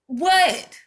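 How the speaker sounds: sharp high disbelieving what; incredulous. Real, not acted. Calling out churches, a loud incredulous what.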